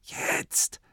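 breathy whisper of "Jetzt"; "Jetzt" fully whispered, no voice; breathed. Acted. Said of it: "hauchte er".

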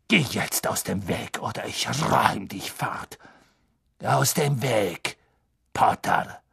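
slow, whispery last warning; hushed, drawn-out menace; menacing threat. A narrator's reading. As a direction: threaten under your breath, word by word.

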